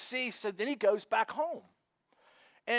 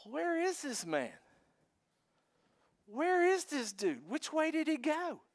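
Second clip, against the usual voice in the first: urgent questioning; 6 semitones higher, 4 dB quieter than usual. Retold or imitated. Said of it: "they ask this".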